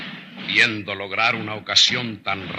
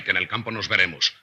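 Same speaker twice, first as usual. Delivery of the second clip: ordinary talk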